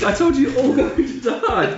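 laughing high gleeful taunt. Acted. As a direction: gloat over doomed players. DM gleefully threatens players.

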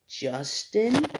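retold hesitant guess, lifting upward; tentative guess. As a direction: imitate someone unsure of a name. Retells man guessing his name.

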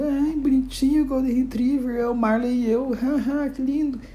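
high-pitched mocking coo; gushing admirers. Passers-by fawning over a Golden Retriever, mock gushing. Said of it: "que todo mundo".

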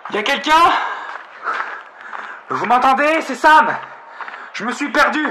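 high, loud desperate calls; calling from far away. Acted. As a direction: yell out, growing desperate. Lost, calling louder for help.

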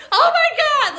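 high, loud, panicked "Oh my God"; flustered panic. Retold or imitated. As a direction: squeal it like a mortified dog owner. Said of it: "like, so flustered".

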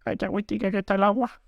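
high, quick fake-casual delight; mock innocent. Wading in dressed while police watch, chirping fake delight. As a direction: play innocent, chirpy and fast.

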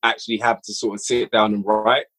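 usual speaking voice

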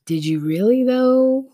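doubtful, pitch climbing high at the end; mock scepticism. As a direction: question it with a loaded rise. Samuel doubting Saul's boast.

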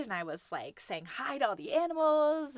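normal talking voice

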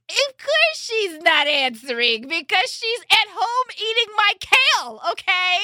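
high, exasperated outburst; sarcastic, fed-up complaint pitched high; exasperated rant. Real, not acted. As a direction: pitch it up in disbelief and annoyance. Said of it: "I'm so upset".

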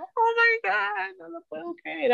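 high, slow, drawn-out gasp of disbelief; stunned disbelief. Retold or imitated. Telling how she and her friend reacted, high drawn-out disbelief. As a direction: stretch out the shock, unable to believe it. Both women stunned by the coincidence.

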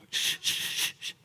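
soft, whispered hushing shh sounds; whispered shushing. Shown as a demonstration. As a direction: hush the room gently. Imitating noise to hush.

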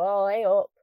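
loud northern English "hey up"; northern greeting to granny. Shown as a demonstration. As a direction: call out a Northern English hello down the phone. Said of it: "then there's my granny, where we pick up the phone".

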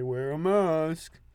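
slow, drawn-out order; lightly voiced nagging instruction; mock nagging sign. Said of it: "places are always like".

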